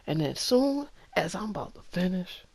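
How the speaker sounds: ordinary talk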